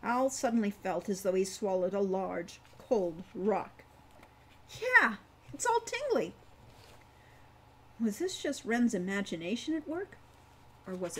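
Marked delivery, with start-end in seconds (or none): 4.8-6.3 put-on excited child. A narrator's reading. Wren excited, very high.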